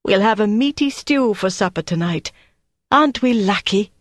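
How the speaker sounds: upbeat, high-pitched cheer for an unresponsive husband; cheery coaxing. A narrator's reading. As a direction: lift the pitch to cheer someone up. Wife voiced cheering up vacant husband.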